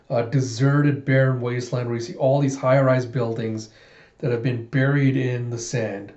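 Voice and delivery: ordinary delivery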